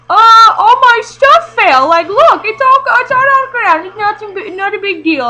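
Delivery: high, loud, slow fake-casual brush-off; hiding the fall from his mom, high fake-cheerful; faking it's fine. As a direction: cover up the fall, chirpy and high. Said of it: "I was just like".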